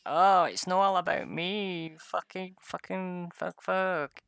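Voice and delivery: mock a self-important player; mocking the paladin. Gamer mocking the Paladin player's attitude, whiny mock. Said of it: "people like the Paladin who are like".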